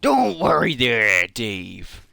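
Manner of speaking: slow, drawn-out pirate voice; pirate accent. Acted. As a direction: welcome the crew aboard like a pirate. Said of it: "uh, pirate accent".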